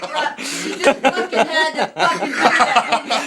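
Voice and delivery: laughing. Real, not acted. Victim laughs at prank.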